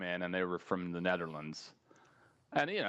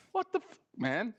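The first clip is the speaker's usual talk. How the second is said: mock disbelief; 12 semitones higher than usual. Left alone holding paintbrushes.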